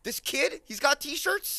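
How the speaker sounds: high-pitched incredulous questions; amazed goblin. Acted. Goblin hears about kid's T-shirts, high and amazed. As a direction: pipe up high in disbelief.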